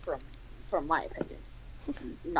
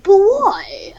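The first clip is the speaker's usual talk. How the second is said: high, loud put-on voice; exaggerated high-pitched comic chef; chef character; 10 semitones higher, 7 dB louder than usual. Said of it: "gotta give him a better voice".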